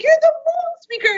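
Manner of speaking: very high, loud starstruck cry; starstruck exclamation. Retold or imitated. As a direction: blurt it like meeting a celebrity. Fellow recognizes the speaker.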